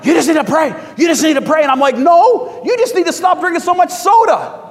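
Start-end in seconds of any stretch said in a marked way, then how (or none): 0.0-1.6 high, loud mimicry of insistent churchgoers; worked-up urging. Churchgoers pressing the preacher for an answer, mock worked-up shouting. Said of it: "they're all worked up like that". 2.0-4.6 high, loud shouted comeback; exasperated retort. Retold or imitated. Preacher answering worked-up churchgoers, exasperated shouted retort.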